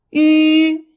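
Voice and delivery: "Yu" high, loud and level; first tone. Shown as a demonstration. Teacher leads repeat drill, high level yu. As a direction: hold "Yu" on one high note. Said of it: "The first tone is the highest".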